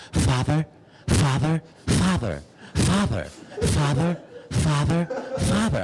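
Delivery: whiny cartoon-kitten teen voice; teen son voice. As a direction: nag 'Father' over and over like a cartoon kitten. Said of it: "this is what you hear".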